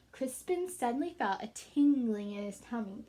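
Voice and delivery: plain reading voice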